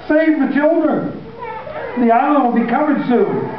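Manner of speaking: high, urgent shout; father shouting to his sons in the boat; shouted into the wind. A narrator's reading. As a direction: yell it over the wind. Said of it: "shouted into the wind".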